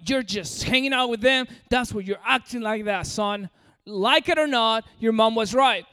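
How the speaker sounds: normal talking voice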